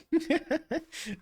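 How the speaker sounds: high burst of real laughter; real high laugh at himself. Real, not acted. Laughing at his own pronunciation.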